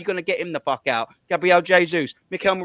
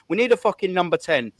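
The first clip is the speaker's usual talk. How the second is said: ordinary delivery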